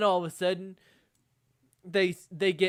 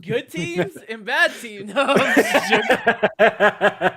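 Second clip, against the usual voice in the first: laughing; 5 semitones higher, 11 dB louder, slower than usual. Real, not acted. Laughing through a joking jab.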